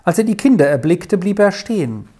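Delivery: usual speaking voice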